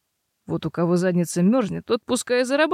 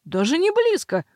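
protesting; 4 semitones higher, 3 dB louder, slower than usual. A narrator's reading. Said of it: "хором возразили мужчины".

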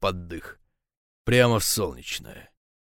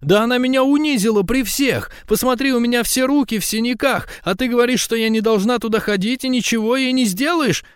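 high, loud outraged woman's voice; wound-up, indignant high-pitched tirade; riled up; 5 dB louder than usual. A narrator's reading. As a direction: protest at the top of the voice, very high. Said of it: "взвелась".

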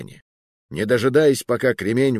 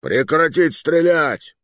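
loud, slow, higher-pitched command; roared order; 7 semitones higher, 5 dB louder, slower than usual. A narrator's reading. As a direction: bellow it over gunfire, stretching the words. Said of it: "рёв Ганса". (командный рёв)

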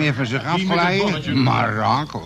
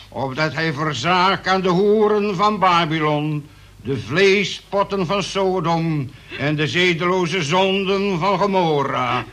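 preaching at full force; fiery prayer; 4 semitones higher than usual. Acted. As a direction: call down wrath like a preacher. Second prayer after tea.

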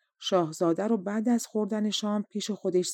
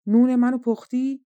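loud, raised-pitch demand; shouting; 5 semitones higher, 7 dB louder than usual. A narrator's reading. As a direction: shout the question as the prince. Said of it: "شاهزاده داد زد". (فریاد بلند و طلبکارانه)

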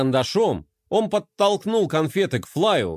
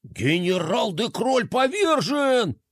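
slow, high, dramatic shout; 7 semitones higher, slower than usual. A narrator's reading. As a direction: cry out in comic dismay. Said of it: "закричал сконфуженный кролик".

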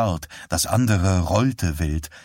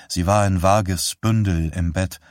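plain reading voice

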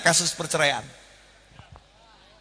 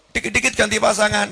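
ordinary delivery

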